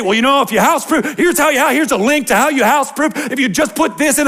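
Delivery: a hint of a tactless pastor rattling off tips; mock know-it-all pastor. Pastor mocking himself lecturing grieving parents, fast and high. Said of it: "Is that the time for me to begin to say".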